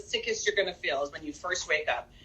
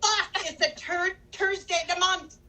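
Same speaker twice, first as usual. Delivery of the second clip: much higher, hammed-up Irish accent; Pauly's Irish accent; 9 semitones higher than usual. Co-host repeating her Pauly joke, high mock Irish accent. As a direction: play up a comic Irish brogue, pitched high. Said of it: "I was waiting for him to say".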